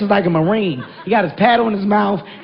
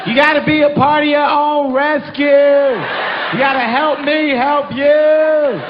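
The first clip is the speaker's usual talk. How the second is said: much higher, slow, drawn-out teasing; mock lifeguard taunt; 8 semitones higher, slower than usual. Husband taunts his struggling wife with the guide's line, slow mock. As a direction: drag it out like a smug rafting guide. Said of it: "I'm like".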